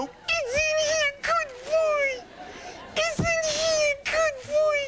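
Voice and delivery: put-on very high, slow sing-song baby talk; baby talk to puppy. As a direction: coo at the puppy like a doting owner. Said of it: "and I go".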